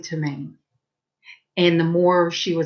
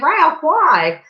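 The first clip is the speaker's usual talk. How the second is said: higher, startled question to a friend; a touch of a louder, puzzled why; surprised why; 9 semitones higher, 4 dB louder than usual. Retold or imitated. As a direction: ask in mock disbelief. Surprised he was scared.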